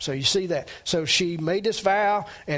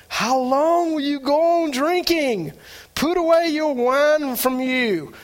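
higher, louder stern priest scolding; accusing rebuke; 8 semitones higher, 4 dB louder than usual. Acted. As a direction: scold her like a suspicious old priest. Eli rebukes supposedly drunk Hannah.

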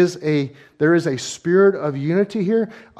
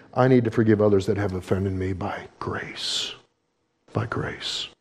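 whispered vow; 8 semitones lower, 5 dB quieter than usual. Pretend. Apply that and say.

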